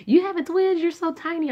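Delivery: high, loud amazed question; amazed coworker. Retold or imitated. Coworker amazed she's carrying twins, high and loud. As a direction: marvel at how tiny she is.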